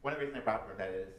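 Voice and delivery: ordinary talk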